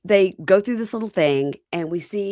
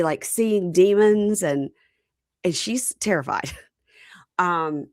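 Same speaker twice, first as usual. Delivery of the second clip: ordinary talk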